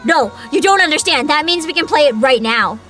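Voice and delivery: usual speaking voice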